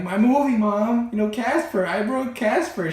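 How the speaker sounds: put-on high, loud child's wail; mock crying. Crying boy tells mom about broken Casper movie, wailing. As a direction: imitate a distraught kid wailing to mom. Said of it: "I'm just crying and crying".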